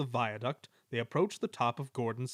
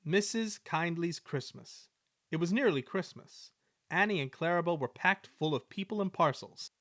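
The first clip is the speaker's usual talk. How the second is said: ordinary delivery, nothing marked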